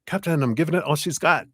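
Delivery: high, quick mock Scottish accent; playful attempt at a Scottish accent; Scotty. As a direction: try a hammed-up Scottish engineer's cry. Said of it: "Scottish accent like Scotty on Star Trek".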